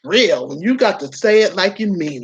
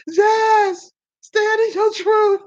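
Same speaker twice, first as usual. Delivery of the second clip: high and breathless, laughing to tears; crying with laughter; 13 semitones higher, much slower than usual. Real, not acted. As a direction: squeak it out through tears of laughter. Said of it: "laughing so hard she cries".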